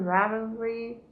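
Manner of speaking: ordinary delivery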